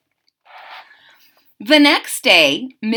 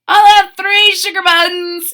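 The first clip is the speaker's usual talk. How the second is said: put-on higher, louder boy asking; a lightly voiced little boy; eager high boy order; boy's voice; 9 semitones higher, 8 dB louder than usual. A narrator's reading.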